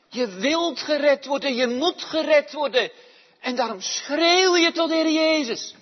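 loud, high, crying-out sermon; impassioned preaching. Acted. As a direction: shout it as a cry for life. Preaching at full force. (vurige prediking op volle kracht)

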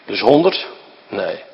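normal talking voice

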